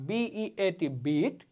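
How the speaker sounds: slowly spelling b-e-a-t then beet; very slow demo of beet; slow spelled-out beet. Shown as a demonstration. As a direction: draw it out letter by letter. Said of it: "দীর্ঘ স্বর".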